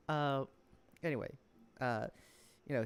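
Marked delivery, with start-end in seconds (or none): none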